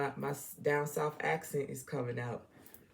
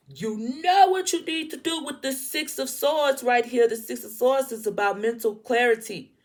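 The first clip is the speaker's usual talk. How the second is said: loud, raised pitch, insistent yelling; worked-up scolding yell, higher and louder; 5 semitones higher, 7 dB louder than usual. Real, not acted. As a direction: yell it like you're fed up with them repeating mistakes. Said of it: "I'm sorry for yelling".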